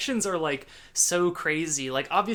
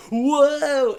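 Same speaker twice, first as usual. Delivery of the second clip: surprised whoa; 10 semitones higher, 9 dB louder than usual. Retold or imitated. Retelling her reaction to the movie.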